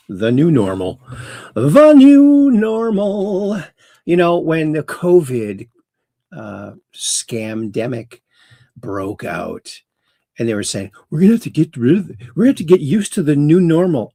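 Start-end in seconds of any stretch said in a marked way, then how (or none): 1.5-3.6 high, loud, drawn-out mock proclamation; hammed-up catchphrase. Host repeats the show's catchphrase for effect, hammed-up. 11.1-14.1 quick, higher-pitched mocking quote; mocking imitation. Host mimics what they said during covid, mocking imitation. Mimicking officials' hated pandemic slogan.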